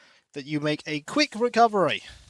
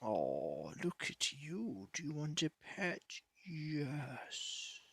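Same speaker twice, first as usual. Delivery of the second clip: soft cooing to the cat; 12 dB quieter, slower than usual. Real, not acted.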